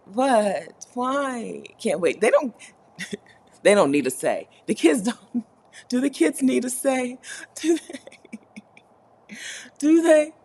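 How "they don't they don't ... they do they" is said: laughing. Real, not acted. Laughing disbelief about kids.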